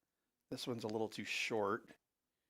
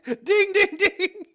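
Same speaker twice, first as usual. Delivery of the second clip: very high, loud sung dings through a laugh; hammed-up high bell dings; mock ding sound; 15 dB louder, slower than usual. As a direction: chime like a game-show bell, laughing.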